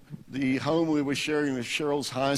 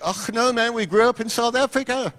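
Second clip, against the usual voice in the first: put-on broad South African accent, pitched much higher; mock South African protest, higher and a bit louder; 10 semitones higher, 3 dB louder than usual. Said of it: "You guys have an accent".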